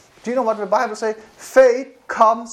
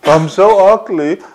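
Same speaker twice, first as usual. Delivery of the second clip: slow, louder mock lament; mock self-pity; 8 dB louder than usual. Preacher mocking a believer's self-talk, slow louder mock lament. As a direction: drag it out like a sulky self-insult. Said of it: "we lie to ourselves".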